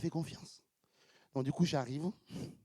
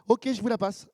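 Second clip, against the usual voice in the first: mock secretary voice; 8 semitones higher, 9 dB louder than usual. Secretary finally puts him through.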